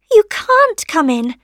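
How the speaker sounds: high little rose voice; rosebud refusing. A narrator's reading. Rosebud keeping sunshine out, high refusal.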